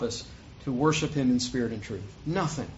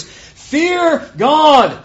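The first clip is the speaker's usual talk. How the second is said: high, booming proclamation, drawn out; loud scriptural proclamation; 12 semitones higher, 13 dB louder, much slower than usual. A narrator's reading. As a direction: cry it out as a loud command. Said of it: "he said with a loud voice".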